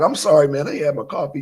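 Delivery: ordinary talk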